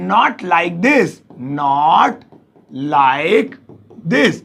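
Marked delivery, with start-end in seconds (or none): 1.4-4.3 words set apart, lower and slow. Shown as a demonstration. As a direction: dictate each word. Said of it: "उसे बोलेंगे ऐसे नहीं". (एक-एक शब्द धीरे-धीरे)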